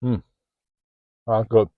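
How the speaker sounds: talking through a mouthful; words round a bite of food; mouth full. Real, not acted. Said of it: "Sorry to talk with my mouth full".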